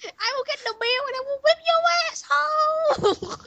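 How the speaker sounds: very high, strained squeak; high squeaky voice. Pretend. Doing a mock impression for friends, high squeaky strain. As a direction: squeeze it up into a crying squeak.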